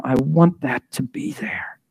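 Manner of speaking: hushed, breathy plea to God; whispered prayer. Acted. Preacher voicing a believer praying to God, whispered. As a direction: whisper the wish like a private prayer.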